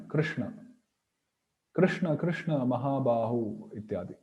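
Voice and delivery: "Krishna" with the tongue curled back on each sh; retroflex sh. Shown as a demonstration. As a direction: recite "Krishna, Krishna, Mahabahu" with a retroflex sh. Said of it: "the tongue must take a twist".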